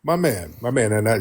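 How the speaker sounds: ordinary talk, nothing marked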